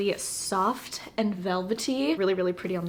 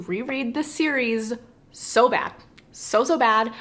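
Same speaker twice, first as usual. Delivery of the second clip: louder, pleading emphasis on so bad; eager longing; 3 semitones higher, 5 dB louder than usual. Real, not acted. To viewers, aching to reread the series, louder. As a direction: press the words with yearning.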